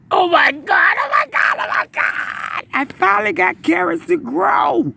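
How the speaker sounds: high, loud shrieks of joy; ecstatic shouting. Real, not acted. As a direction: scream with disbelieving delight. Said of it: "forgive me for breathing so hard".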